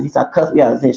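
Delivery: normal talking voice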